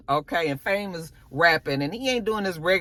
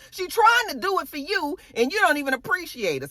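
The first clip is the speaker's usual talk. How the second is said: mock fussing mother; 6 semitones higher than usual. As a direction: scold him like a fed-up mom. Rock fussing at Kanan.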